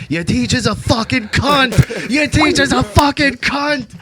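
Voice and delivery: slow, high, loud chanted taunt; sing-scream it over the speaker to embarrass him; drawn-out mocking chant, yelled; screaming sing-song. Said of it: "I'm sitting there screaming".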